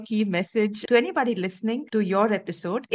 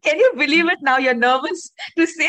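excited teasing; 5 semitones higher, 8 dB louder than usual. Real, not acted. Host teasing guest, laughing.

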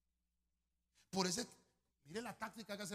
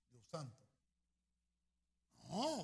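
ordinary delivery

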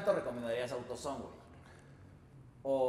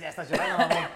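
teasing laugh; 3 semitones higher, 8 dB louder than usual. Real, not acted.

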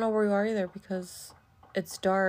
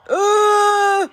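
startled grunt; 11 semitones higher, 10 dB louder than usual. Real, not acted. Startled in game.

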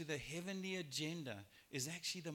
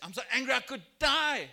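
much louder, higher furious outburst; furious Jonah; 8 semitones higher, 12 dB louder than usual. Retold or imitated. Jonah shouting at God that he is angry enough to die. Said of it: "absolutely furious".

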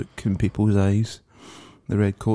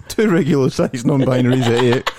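bingo caller; 4 semitones higher, 3 dB louder than usual. Retold or imitated. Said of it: "bingo calling".

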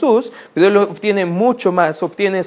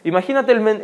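usual speaking voice

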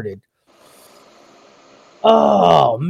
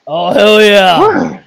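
drawn-out hype cheer; 3 semitones higher, 8 dB louder, much slower than usual. Real, not acted. Cheering after a shot.

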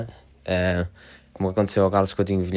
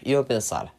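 ordinary talk, nothing marked

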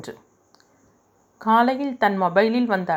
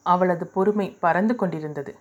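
plain reading voice

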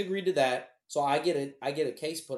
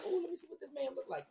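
much higher, softer nosy voice; mock curious date; 12 semitones higher, 8 dB quieter than usual. Friends of a date snooping online, higher mock curiosity. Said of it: "'cause they're like".

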